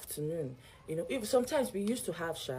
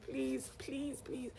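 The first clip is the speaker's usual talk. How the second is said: high, soft, repeated pleading; begging; 8 semitones higher, 7 dB quieter, much slower than usual. Retold or imitated. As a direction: re-enact a small, high plea. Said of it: "I begged him".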